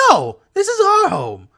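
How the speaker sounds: high, loud goblin cry; desperate high-pitched protest; defiant goblin. Acted. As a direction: refuse in a shrieked goblin voice. Goblin refuses to leave home.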